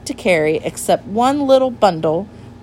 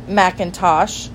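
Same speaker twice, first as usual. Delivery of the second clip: ordinary delivery, nothing marked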